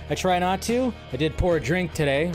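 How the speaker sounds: ordinary talk, nothing marked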